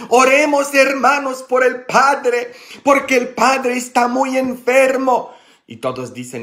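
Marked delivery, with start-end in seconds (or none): 0.1-5.3 calling out high and loud to the whole prayer group; a devout lady announcing to the room at full voice. Retold or imitated. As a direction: proclaim it like a public prayer request. Lady proclaims before prayer group.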